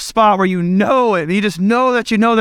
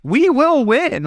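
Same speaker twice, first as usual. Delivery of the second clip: higher, slowed parent vow; pitched-up, slow mock threat; determined parent; 4 semitones higher, slower than usual. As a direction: insist you and your wife will win. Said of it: "I'll tag my wife".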